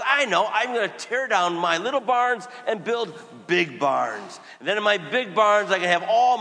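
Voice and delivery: loud, high, slowed gloating farmer; boastful rich farmer. Retold or imitated. Priest voicing the rich fool planning bigger barns, loud and gloating. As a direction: crow over the big barns like the greedy rich man.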